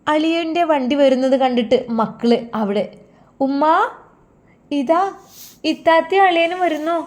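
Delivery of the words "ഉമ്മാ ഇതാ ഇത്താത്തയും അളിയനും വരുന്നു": high, bright excited call to mother. Acted. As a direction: call out the news, thrilled. Kids call out father's arrival.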